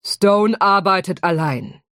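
put-on slow, firm British-accented contradiction; crisp British accent. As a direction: contradict her in precise British English. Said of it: "widersprach der Drache".

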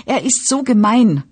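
put-on high whine, helpless girl complaining; whining voice. A narrator's reading. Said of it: "bestätigte sie mit Jammerstimme".

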